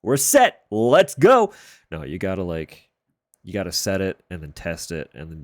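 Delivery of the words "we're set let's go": high, loud, gung-ho mock cheer; hammed-up "ready to go" shout; mock overconfident. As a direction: squeal it like it's all sorted, way too eager. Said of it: "and be like".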